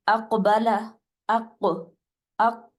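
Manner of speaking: اق with the قاف bounced back; qalqala demo. Shown as a demonstration. Teacher drills the qalqala sound slowly. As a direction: break اقبل into اق, اق with an echoing قاف. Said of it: "دو دفعہ حرف کی آواز آ رہی ہے نا کاف کی".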